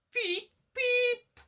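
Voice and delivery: very high, choked whistle beeps; spluttering. A narrator's reading. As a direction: splutter through water. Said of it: "he spluttered".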